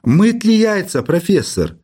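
high-pitched worried query; mildly anxious caller asking for advice; alarmed. A narrator's reading. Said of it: "сказал в ухо встревоженно".

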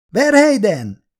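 high, loud called question; calling question. A narrator's reading. Scientist calling to the fallen soldier, high and loud. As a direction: call down into the trap. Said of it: "kérdezte".

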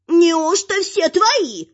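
slow, high-pitched wonder; admiring troll woman. A narrator's reading. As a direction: marvel in a drawn-out troll-wife voice. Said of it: "восхищённо и более миролюбиво".

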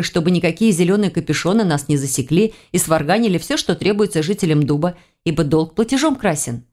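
ordinary delivery

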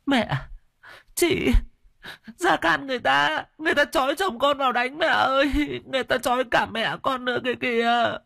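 very high, sobbing, rushing at the end; crying in terror. Acted. Terrified widow cries to her mother and sister-in-law, high sobbing plea. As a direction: cry out for help in panic. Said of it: "hốt hoảng dãy nảy lên".

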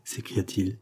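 ordinary delivery

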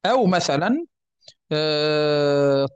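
ordinary delivery

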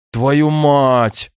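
a sharp, loud curse that bursts out; swearing outburst. Acted. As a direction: blurt out the curse. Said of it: "вырвалось у меня".

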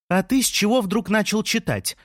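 high-pitched, indignant question; incredulous challenge. A narrator's reading. Лея questions Жан's sudden reading, incredulous and high. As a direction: challenge him in disbelief. Irritated woman challenges her partner.

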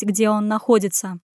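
plain reading voice

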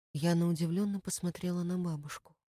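ordinary delivery, nothing marked

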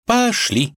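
put-on high, decisive girl's call; young woman voice. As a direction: lead them off briskly. Vasilisa voiced in story.

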